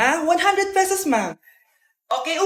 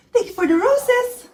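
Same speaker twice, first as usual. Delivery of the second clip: TikTok NPC live; 6 semitones higher, slower than usual. Pretend. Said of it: "yung A I na trend sa TikTok, yung nagla-live".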